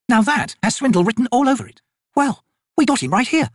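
high-pitched, dry soldier voice; sceptical soldier. Acted. Soldiers weigh up the trader's claim, sceptical. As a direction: call out a swindle.

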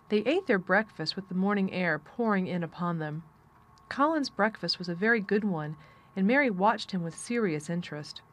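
usual speaking voice

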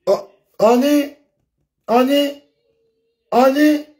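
high, loud, drawn-out honey call; playful high-pitched honey call; mock sweet call.

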